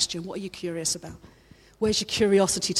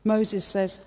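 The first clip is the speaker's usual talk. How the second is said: ordinary talk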